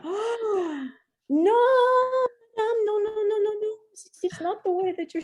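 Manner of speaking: very high, alarmed mock French accent; hammed-up French official, high-pitched; bad French accent. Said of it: "bad French accent".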